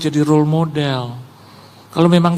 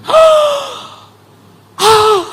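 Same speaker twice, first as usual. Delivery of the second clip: high, loud intake of breath; exaggerated gasps of astonishment; imitated shocked gasp; 6 dB louder than usual. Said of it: "begitu lihat saya gini". (tiruan napas kaget melengking)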